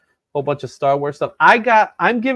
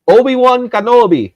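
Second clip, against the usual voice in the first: loud, higher mock-Spanish accent; playful Spanish accent; 6 semitones higher, 7 dB louder than usual. Host showing giveaway books to viewers, playful Spanish accent. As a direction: roll the name out in a put-on Spanish accent. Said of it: "I'm gonna say things in Spanish dialect".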